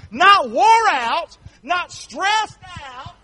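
high, very slow drawn-out words; slow high preacher climax; slow high emphasis. Real, not acted. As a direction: stretch each phrase to the rafters. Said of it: "preacher stretches climax".

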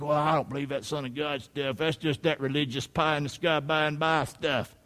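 low, soft mock grumble of a doubter; dismissive sceptic. Preacher voices a doubting churchgoer, dismissive soft mumble. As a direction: lightly imitate a sceptic, under the voice. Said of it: "you might think".

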